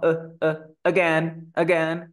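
"eh" as a bare schwa, then "again" with that reduced A; schwa demo. Shown as a demonstration. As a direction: barely sound the A in "again". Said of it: "ni siquiera suena la A". ("again" con la A reducida a schwa)

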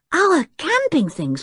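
high, slow dismayed cry; cried out. A narrator's reading. George hearing her gear will be burnt, high dismayed cry. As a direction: wail it in dismay. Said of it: "cried George".

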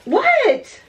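high-pitched "what" at the dog; sudden high, sharp question; exasperated call. Real, not acted. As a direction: break off to call high at a pet. Calling to her barking dog.

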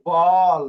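বল loud and drawn out, "bawl"; example word demonstrated. Shown as a demonstration. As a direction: sound out বল strongly for the learners. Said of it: "যেরকম বি".

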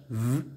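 "v" 소리만 따로 길게 낸다; isolated v sound. Shown as a demonstration. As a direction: voice the v alone, buzzing. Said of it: "eh bien le V".

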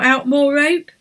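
ordinary delivery, nothing marked

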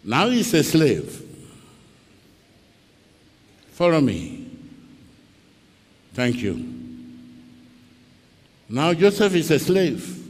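ordinary talk

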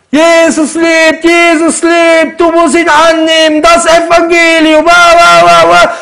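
slow, high, mocking shout; mock shouting street preacher; imitating screaming missionary. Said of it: "Ist die ganze Zeit am Schreien".

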